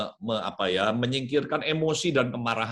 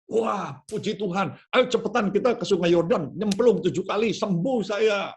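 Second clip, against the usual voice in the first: eager joy; 7 semitones higher than usual. Acted. Naaman eager to go bathe.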